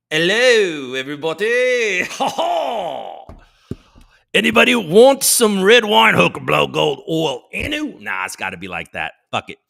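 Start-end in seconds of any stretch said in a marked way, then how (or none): 0.1-8.0 loud, hammed-up British lord; booming mock-British character with a big laugh; bad British accent. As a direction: put on a poor, over-the-top British accent. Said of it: "I'm just not very good at the British accent".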